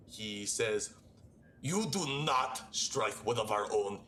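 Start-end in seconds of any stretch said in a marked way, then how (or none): 1.6-4.0 livid Helmrich. Acted. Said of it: "like, livid".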